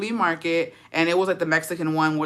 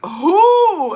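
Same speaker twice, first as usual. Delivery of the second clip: high, loud, drawn-out who; mock startled question; 11 semitones higher, 8 dB louder than usual. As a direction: blurt it out high, acting surprised. Acting out her startled question.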